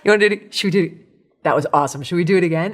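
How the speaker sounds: eager invitation. Pretend. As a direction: grin through it and egg them on. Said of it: "it's gonna say only one thing to you".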